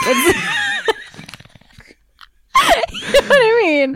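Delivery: high laughing shout. Real, not acted. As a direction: burst out laughing while asking. Cracks up laughing mid-sentence.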